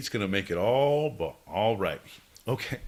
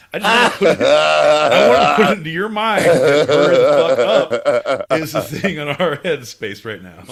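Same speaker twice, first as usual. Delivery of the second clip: shouting; 3 semitones higher, 11 dB louder than usual. Acted. Yelling comic rant at cohost.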